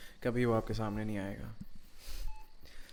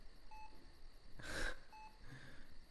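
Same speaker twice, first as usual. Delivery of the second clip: nervous laugh; 13 dB quieter than usual. Acted.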